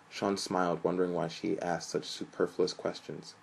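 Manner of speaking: ordinary delivery, nothing marked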